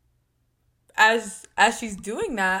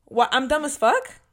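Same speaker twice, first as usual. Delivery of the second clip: higher, louder challenging question; confused, confrontational; 5 semitones higher, 7 dB louder, slower than usual. Retold or imitated. Confronting the boy who insulted her in the car, confused and confrontational. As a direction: call him out in mock bewilderment. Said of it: "I said it confused".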